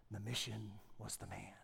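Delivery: breathy whispered tagline; hushed whisper. Pretend. Preacher whispering the film tagline to the church. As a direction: whisper it like a movie trailer.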